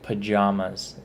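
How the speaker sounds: "pajamas" said puh-JAH-muz; pa-JAH-mas pronunciation. Shown as a demonstration. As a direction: middle vowel of "pajamas" as in "father". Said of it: "is how I say it".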